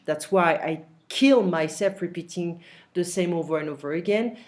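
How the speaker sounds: usual speaking voice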